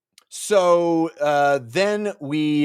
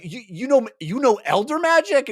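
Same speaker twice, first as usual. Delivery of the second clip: astonished question; 12 semitones higher, 4 dB louder than usual. Retold or imitated. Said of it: "he's like".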